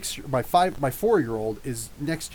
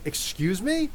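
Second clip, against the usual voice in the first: higher, rising mock-affronted question; indignant woman, imitated; 6 semitones higher, slower than usual. Crossing guard answering being told to shut up, mock indignation. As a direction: echo her offended disbelief, pitch climbing at the end. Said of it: "She's like".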